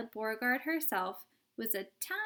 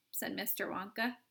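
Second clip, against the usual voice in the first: ordinary delivery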